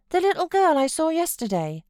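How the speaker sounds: put-on a fretful child pleading to stay; sickly child. A narrator's reading. Boy refusing to leave his uncle, high fretful whine. Linton asking about Cathy.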